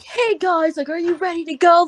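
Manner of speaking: high-pitched hype-man announcer. Retold or imitated. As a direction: pump up the crowd like a hype man. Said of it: "these hype men, like, narration".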